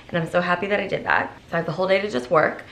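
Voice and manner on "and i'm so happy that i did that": usual speaking voice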